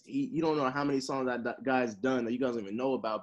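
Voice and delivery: ordinary talk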